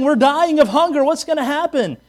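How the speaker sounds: high, loud mock wail; lightly hammed-up panic; mock whining Israelites. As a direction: whine it like a grumbling crowd. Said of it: "They're saying".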